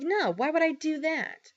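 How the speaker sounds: high, sneering dismissal; lightly hammed-up scornful mother; mock dismissive mom. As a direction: imitate a snotty brush-off. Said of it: "And she's like".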